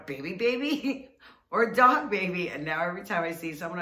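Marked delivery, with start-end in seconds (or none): 0.1-2.5 put-on higher, louder, laughing baby baby; laughing repeat. The two women joking at every stroller. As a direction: giggle it out like a running joke. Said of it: "kept looking at every stroller going".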